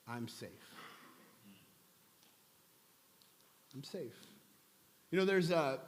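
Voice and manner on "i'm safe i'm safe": much softer and lower, tender; gentle reassurance. Retold or imitated. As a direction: hush it like comforting someone timid. Gentle reassurance voiced to her.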